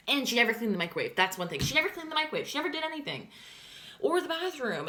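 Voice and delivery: high, loud, fast exasperated complaint; exasperated rant. Real, not acted. Venting to viewers about her roommate, exasperated and loud. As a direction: let the frustration spill out, pitched high.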